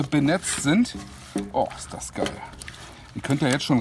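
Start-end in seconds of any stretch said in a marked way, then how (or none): 1.5-2.3 awed whisper. Real, not acted. Awed at sauced wings.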